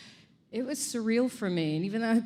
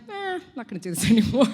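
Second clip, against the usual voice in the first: fast, laughing shrug-off; giving up the accent, quick and laughing; laughing dismissal; 6 semitones higher, 7 dB louder, much faster than usual. Retold or imitated. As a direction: toss it away with a laugh. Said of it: "and then I was like".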